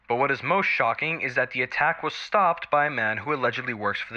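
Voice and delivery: TV news read. Acted. News anchor reporting an attack to viewers, crisp news read.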